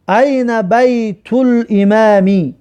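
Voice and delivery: slow model reading. Shown as a demonstration. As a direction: draw each word out for the class. Teacher reads Arabic example sentence.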